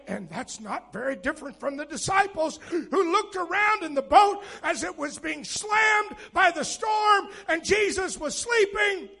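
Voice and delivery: ordinary talk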